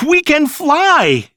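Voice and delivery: very high, loud and slow joyful cry; cheer. A narrator's reading. Children rising into the air, high slow cheer. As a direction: let the joy soar out. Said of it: "cheered Wendy".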